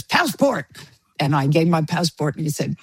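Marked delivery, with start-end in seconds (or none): 0.0-0.7 higher-pitched imitation of a stern official; scary border guard. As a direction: lightly play an intimidating passport officer. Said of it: "very scary in his uniform".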